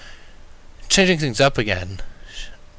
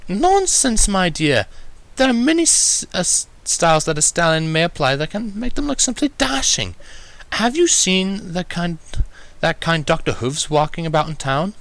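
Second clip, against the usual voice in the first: posh Rarity voice; 8 semitones higher than usual. Acted. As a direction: play the fashionable unicorn, airy and gracious.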